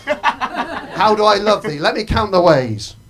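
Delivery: slow, grandly drawn-out love poem; slow over-the-top poetry reading; mock poetry recital. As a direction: ham up the romantic verse. Mock recital of famous poems.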